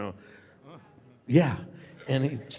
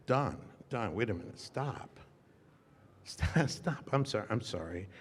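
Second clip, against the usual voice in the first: hushed plea; 7 dB quieter than usual. Retold or imitated. Retold plea to co-star.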